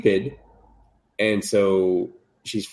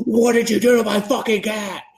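much higher mock panic; shrill freak-out; 13 semitones higher, 3 dB louder than usual. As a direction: shriek it like a scared cartoon boy. Boy freaking out in recap.

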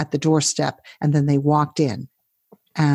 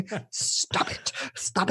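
whisper; 2 semitones higher, 9 dB quieter, slower than usual. Real, not acted. Laughing plea to stop impression.

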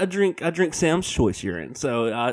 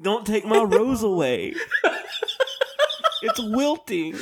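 higher, laughing mock-tragic plea; mock dramatic plea; 9 semitones higher than usual. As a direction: clutch your wilting rose and beg. Joking plea about his rose.

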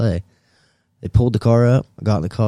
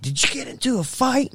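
mocking the wife; 13 semitones higher, 5 dB quieter than usual. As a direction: ham up his wife's exasperated wail. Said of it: "She's like".

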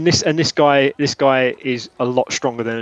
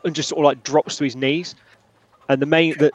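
usual speaking voice

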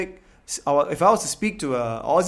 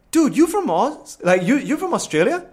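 high-pitched, eager questioning; Indian Aussie dude; 8 semitones higher, 4 dB louder than usual. Retold or imitated. Aussie dude asking a performer where he's from, high-pitched imitation. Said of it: "An Indian Aussie dude was like".

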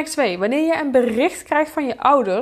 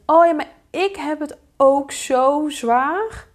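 slow, higher, self-pitying whine; milk the sympathy, drawn out; playing the victim; 6 semitones higher, slower than usual. Parent turns into the victim, slow self-pitying whine. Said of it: "in de slachtofferrol gaan zitten".

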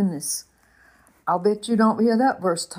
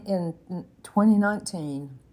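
usual speaking voice

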